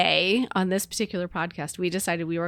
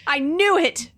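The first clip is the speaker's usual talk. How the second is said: dramatic outburst; 9 semitones higher, 6 dB louder than usual. Pretend. Mock soap reply.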